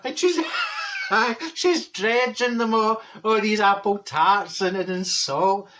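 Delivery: laugh, then drag the words out; helpless laughing. Real, not acted. Cracking up over Fanny's salted tarts, laughing. Laughing while telling punchline.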